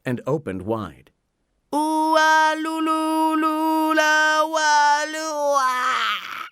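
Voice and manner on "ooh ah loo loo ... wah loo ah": very high, loud, long-drawn yodelling cry; drawn-out cartoon holler that squeaks at the end; wailing oyster call. A narrator's reading. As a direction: wail a silly animal call, ending in a pathetic squeak. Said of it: "he cried, ending with a pathetic squeak".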